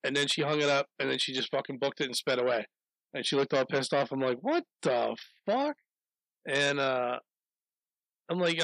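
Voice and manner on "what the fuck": baffled. Retold or imitated. Baffled as she speeds off.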